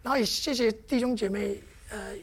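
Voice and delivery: ordinary talk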